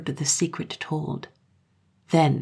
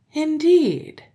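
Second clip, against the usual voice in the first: high, loud amused "Indeed"; high amused girl voice; 12 semitones higher, 6 dB louder than usual. A narrator's reading. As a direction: say it as a knowing girl. Girl remarks.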